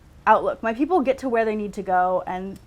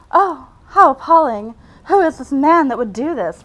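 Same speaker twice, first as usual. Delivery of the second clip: high, loud, hammed-up horror; mock scandalized; 5 semitones higher, 9 dB louder than usual. Reader reacts to the blood-soaked hero, mock outrage. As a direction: play at being appalled, with swooping pitch.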